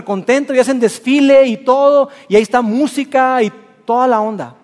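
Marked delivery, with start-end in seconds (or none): none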